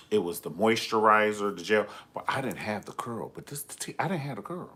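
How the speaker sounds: normal talking voice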